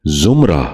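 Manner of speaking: زمرہ with short u, "zum-ra"; correct زمرہ. Shown as a demonstration. Teacher modelling for viewers, زمرہ said as "zumra". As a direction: start زمرہ with zum, not zim. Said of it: "دوبارہ سنیے".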